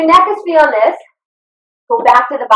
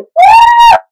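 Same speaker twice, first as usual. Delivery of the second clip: very high, loud cheering woo; a big shouted woo mid-set; loud whoop; 7 dB louder than usual. Real, not acted. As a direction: whoop to pump everyone up. Instructor cheering through burning set.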